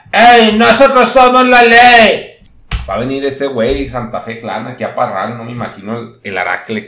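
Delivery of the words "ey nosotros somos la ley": higher, much louder, slow shouted boast. Retold or imitated. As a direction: bellow it like a gang motto.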